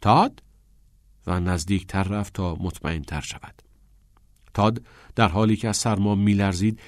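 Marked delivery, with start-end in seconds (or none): 0.0-0.4 higher, louder questioning call of a name; raised rising call. A narrator's reading. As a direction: call to a friend, unsure it is them. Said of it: "صدایش زد".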